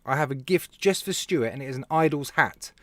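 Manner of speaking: usual speaking voice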